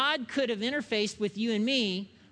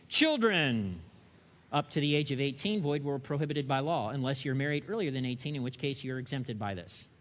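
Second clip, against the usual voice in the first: mock legal fine print; 7 semitones lower than usual.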